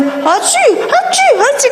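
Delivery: high laughing mock sneezes; sneezing. Telling the congregation about the dusty nose, mock sneezes. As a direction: sneeze it out comically. Dust making her sneeze.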